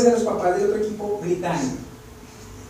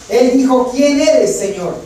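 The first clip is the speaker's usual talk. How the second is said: louder, higher, ringing voice; preaching at full force; 6 semitones higher, 10 dB louder, slower than usual. Acted. Preacher crying out Paul's question, loud and high. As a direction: proclaim it to the whole hall.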